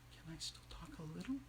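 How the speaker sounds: tiny tentative whisper; barely audible, cautious whispered question. A narrator's reading. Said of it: "and then she whispered".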